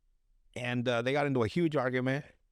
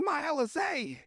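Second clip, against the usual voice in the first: very high-pitched wail of protest; hammed-up high yelp of loss; mock distressed cry; 12 semitones higher than usual. Acts victim losing his drink.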